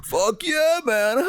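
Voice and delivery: high, spaced-out stoner drawl. Retold or imitated. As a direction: play a blissed-out stoner dude. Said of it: "that's the impression of a stoner".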